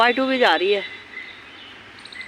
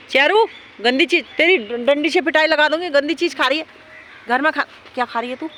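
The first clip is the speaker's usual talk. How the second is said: scolding the dog; 6 semitones higher than usual. Real, not acted. Scolding dog eating dirt.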